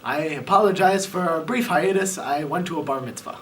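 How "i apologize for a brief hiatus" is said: ordinary delivery, nothing marked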